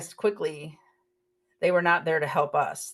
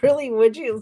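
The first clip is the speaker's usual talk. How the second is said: delighted; 5 semitones higher, 6 dB louder than usual. Retold or imitated. Delighted offer of drinks, laughing.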